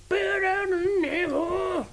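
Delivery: high, slow drawn-out catchphrase; hammed-up announcer. Host echoing co-host, slow high mock announcer. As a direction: stretch it like a ring announcer.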